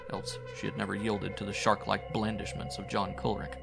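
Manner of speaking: ordinary delivery, nothing marked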